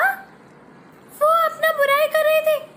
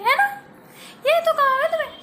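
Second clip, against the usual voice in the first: ordinary talk, nothing marked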